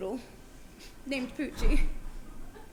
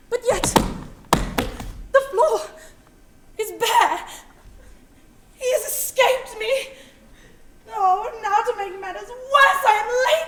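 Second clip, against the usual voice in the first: melodramatic despair; 7 semitones higher, 7 dB louder than usual. Acted. The roach escapes, despair.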